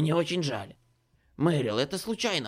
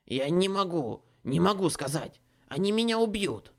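frightened; 6 semitones higher, 6 dB louder than usual. A narrator's reading. Terrified Ace refuses.

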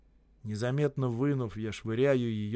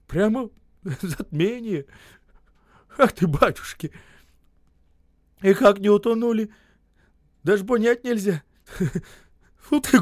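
high, loud, broken penitence; tearful contrite babbling; 10 semitones higher, 11 dB louder than usual. A narrator's reading. Осип apologising to the men, guilty tearful babbling. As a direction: stammer apologies, half laughing, half weeping. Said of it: "стал бормотать".

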